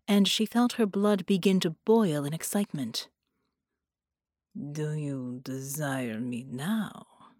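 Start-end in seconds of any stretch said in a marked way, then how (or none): 4.6-7.3 low, slow, hushed demand; a quiet, menacing command, drawn out slowly. Acted. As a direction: seductive villain demands her desire in a low slow voice. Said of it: "he demanded".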